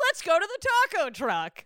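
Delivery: mock buddy cop. Cops inviting their new friend out, mock buddy voice. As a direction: invite him along like an old pal. Playing cops turned buddies.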